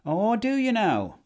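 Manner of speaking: high, slow sarcastic challenge; mock tough-guy retort. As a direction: draw it out, high and taunting. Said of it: "And I was like".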